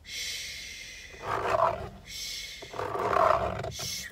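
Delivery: breathy ASMR-style shush. Shown as a demonstration. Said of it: "Should I try one of them?".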